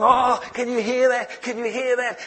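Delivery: high-pitched excited onlookers, imitated. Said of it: "they were all excited".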